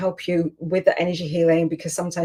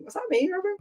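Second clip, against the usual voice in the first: much higher, softer disbelief to Robin; amazed question; 13 semitones higher, 6 dB quieter, faster than usual. Real, not acted. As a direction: ask Robin, delighted and unsure. Asks co-host in amazement.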